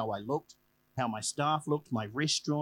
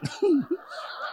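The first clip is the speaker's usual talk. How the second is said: laugh; 3 dB louder than usual. Real, not acted. Laughs at own intensity.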